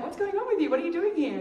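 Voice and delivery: high, loud, delighted disbelief; loud, high happy surprise; surprised greeting. Retold or imitated. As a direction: exclaim like you can't believe who you've run into. Bumping into a friend abroad.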